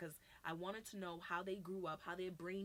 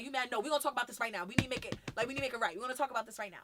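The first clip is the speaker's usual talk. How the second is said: loud, higher-pitched demands; insistent arguing; 5 semitones higher, 11 dB louder, much faster than usual. Retold or imitated. Partner demanding to talk it out now, heated insistence. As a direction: press the point and refuse to drop it. Acted insistent relationship argument.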